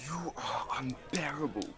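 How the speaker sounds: whisper. Acted. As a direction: whisper it under your breath. Muttered insult after teasing.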